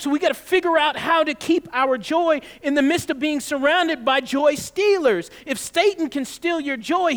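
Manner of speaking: rally the congregation to keep their joy; full-force preaching. Acted. Pastor urging congregation against joy stealers, forceful preaching.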